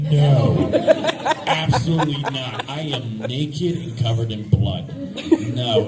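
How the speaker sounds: loud laughing refusal; firm refusal. Retold or imitated. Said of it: "I'm like".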